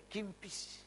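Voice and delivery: put-on soft, high Makassar-accented "kimpis"; logat Makassar. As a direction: say "kimpis" with i in both syllables, Makassar style. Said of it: "istri saya orang Makassar". ("kimpis" berlogat Makassar)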